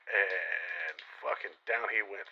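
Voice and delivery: drawn out, every word weighted; slow, heavy storyteller beat; slow dramatic telling. Real, not acted. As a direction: let the fall land slowly. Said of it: "dramatic slow telling of the shot".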